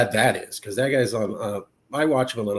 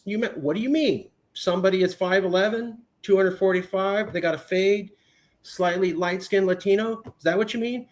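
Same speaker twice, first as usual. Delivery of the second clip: higher, incredulous questioning; indignant driver; 6 semitones higher than usual. Retold or imitated. Driver challenging the officer, higher incredulous voice. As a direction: push back, disbelieving.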